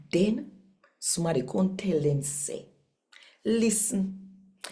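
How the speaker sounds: steady, even Bible reading in Patois; scripture reading. Acted. Host reading Acts to listeners, measured scripture narration. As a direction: read the passage as a daily devotion. Daily Jamaican New Testament reading.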